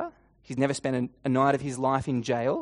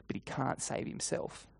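soft, understated taunt; mock him quietly; a hushed touch of scorn; understated sneer; 2 semitones lower, 9 dB quieter than usual. A narrator's reading. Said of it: "they sneer".

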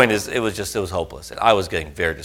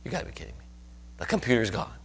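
stunned disbelief; 7 dB quieter, faster than usual. Retold or imitated. Preacher retelling his shock at the lost computer, whispered disbelief. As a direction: hush it out like you can't believe the news. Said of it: "I was like".

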